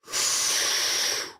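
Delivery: long, audible sigh of breath; deep exhale. Real, not acted. Streamer stunned by the Sixers' big lead, deep exhale. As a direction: let out one deep, drawn-out breath. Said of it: "can we take a deep breath".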